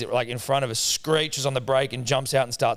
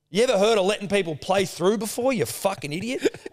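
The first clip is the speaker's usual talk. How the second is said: higher-pitched scolding question; retold angry retort; 8 semitones higher than usual. Dad to golfer who pushed in, mock outrage. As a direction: imitate a dad tearing into someone.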